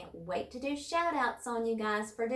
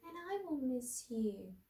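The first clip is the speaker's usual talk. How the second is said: sad and softer, a little higher; 5 semitones higher, 6 dB quieter than usual. A narrator's reading. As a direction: let the voice drop to a sad hush. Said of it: "in a sad, soft voice".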